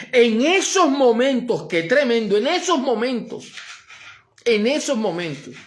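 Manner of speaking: loud, high, driving sermon voice; full-force preaching. Acted. Pastor preaching to the congregation, fervent and loud.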